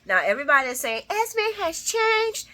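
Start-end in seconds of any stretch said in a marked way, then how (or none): 1.1-2.5 high, slow, sing-song mockery; mocking quote. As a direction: drag it out mocking the crowd. Mocking what everybody says.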